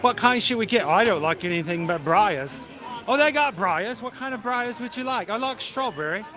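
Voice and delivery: high, sing-song mock British; bad British accent. Brothers choosing Breyers in the aisle, bad British accent. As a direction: play both brothers in hammed-up British accents. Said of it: "bad British accent".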